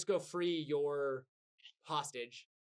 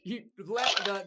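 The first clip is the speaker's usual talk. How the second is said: ordinary delivery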